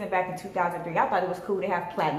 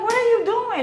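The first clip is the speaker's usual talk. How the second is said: much higher, louder what are you doing; alarmed disbelief; 14 semitones higher, 5 dB louder than usual. Retold or imitated. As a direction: shriek at the driver in disbelief. Driver heads wrong way.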